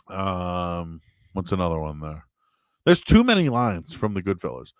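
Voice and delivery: ordinary talk, nothing marked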